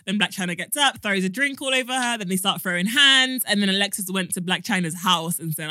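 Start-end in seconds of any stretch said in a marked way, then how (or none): none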